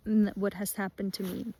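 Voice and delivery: ordinary delivery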